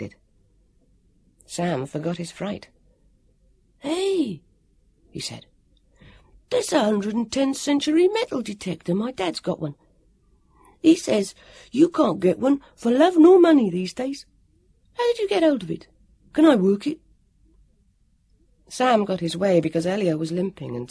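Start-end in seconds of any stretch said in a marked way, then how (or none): 3.8-4.4 put-on high-pitched excited child; excited boy Sam. Sam forgetting his fright, excited boy voice. 6.5-17.0 put-on high, eager child's voice; excited boy Sam. Sam admiring the metal detector, excited boy voice.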